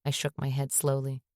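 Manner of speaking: plain reading voice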